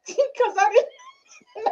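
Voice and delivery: high laughing voice, words stretched out; helpless laughter through the words; laughing admission. Real, not acted. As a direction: crack up while delivering the comeback. Laughs at own comic answer.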